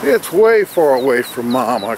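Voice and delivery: ordinary delivery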